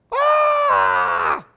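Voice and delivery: loud, sharp little shriek; a tiny bird shrieking in fright; high startled scream. Acted. The owl screams on seeing the bear, loud shriek. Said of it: "screamed a tiny feathered thing".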